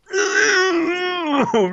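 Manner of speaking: very high, drawn-out trilling noise; imitated sound effect. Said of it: "You hear this".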